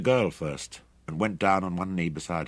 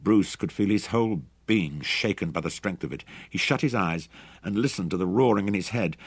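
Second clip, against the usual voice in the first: plain reading voice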